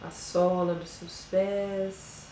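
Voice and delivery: slow, soft meditation voice with long pauses; guided meditation. Acted. Yoga teacher guiding listeners through a body scan, slow and soft meditation voice. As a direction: ease them into calm, one body part at a time.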